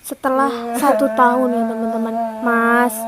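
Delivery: ordinary talk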